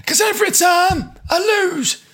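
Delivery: put-on comic northern old-timer protest; put-on northern accent. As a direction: whine it out as an old northerner, pitched right up. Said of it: "Old you's gone northern".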